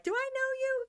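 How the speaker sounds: high, surprised question; puzzled high-pitched question. Retold or imitated. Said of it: "and I was like".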